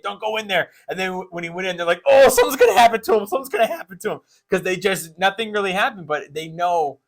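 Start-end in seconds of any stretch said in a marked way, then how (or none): 0.0-0.7 higher, lightly acted warning; imitating worried parents. Parents warning the actor on TV, mock worry. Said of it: "telling Brad Pitt". 2.1-4.2 high, fast imitated fretting; mock panic. Parents watching the ranch scene, mock alarm.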